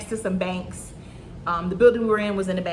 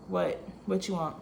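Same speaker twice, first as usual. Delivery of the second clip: low, soft, unwelcoming question; retold curt, low-key response; curt reply; 3 semitones lower, 8 dB quieter than usual. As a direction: answer the boss like he's a nuisance. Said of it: "That's how I talk to him".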